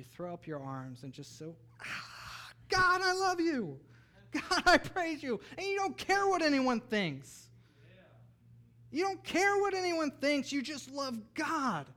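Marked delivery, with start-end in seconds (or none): 1.8-5.4 high, loud, slow cry of praise; gasp, then shout your love like a thrilled child; exuberant worship cry. Acted. Acts out all-out childlike worship. 5.6-11.9 high, loud, slow impassioned exhortation; fervent preaching. Real, not acted. Preacher carried away urging worship.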